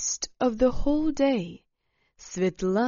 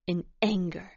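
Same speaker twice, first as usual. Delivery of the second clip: plain reading voice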